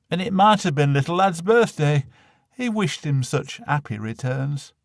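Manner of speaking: ordinary delivery, nothing marked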